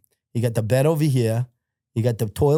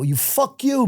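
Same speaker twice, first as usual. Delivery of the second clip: high mock scream at ordinary volume; retold screaming; 12 semitones higher, slower than usual. Prisoner cursing back at hecklers, high mock scream. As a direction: curse back, pitched up. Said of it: "I'm screaming backwards".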